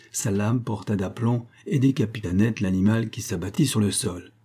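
ordinary delivery, nothing marked

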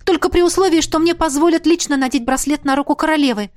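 eager, pressing proviso; quickly added. A narrator's reading. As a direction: add the condition hastily. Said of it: "быстро добавила".

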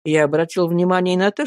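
plain reading voice

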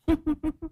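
high, soft ghostly cackle; a short wicked ghost giggle; high soft evil giggle; eerie spirit laugh. Acted. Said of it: "cười tà mị".

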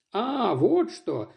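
slow, lisping, halting; slight lisp and stutter. Acted. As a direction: stammer it out with a lisp. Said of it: "слегка заикаясь и немного шепелявя".

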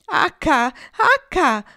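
loud call; shouting. A narrator's reading. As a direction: yell for the leader. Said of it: "presero a gridare le oche".

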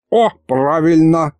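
perked up. A narrator's reading. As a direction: perk up with sudden interest. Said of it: "оживился Михалыч". (оживившись)

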